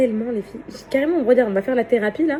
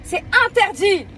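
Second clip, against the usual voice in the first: stern mock warning; 8 semitones higher, slower than usual. Real, not acted.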